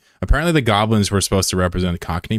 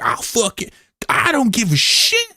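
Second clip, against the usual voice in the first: pitched-up lazy drawl, not caring; mock Florida man. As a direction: ham up a reckless Florida man. Streamer voices Florida man.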